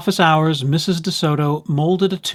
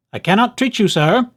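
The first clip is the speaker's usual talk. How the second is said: raised voice through the door; mild shout; 6 semitones higher, 3 dB louder than usual. A narrator's reading. Dentist calling down to the fox, a mild shout. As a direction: call out firmly to the fox. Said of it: "Doctor DeSoto shouted".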